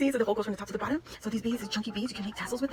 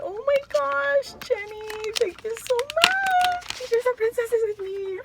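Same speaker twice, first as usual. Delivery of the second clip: excited squeal; 12 semitones higher, 5 dB louder, slower than usual. Real, not acted. Squealing over gifted princesses.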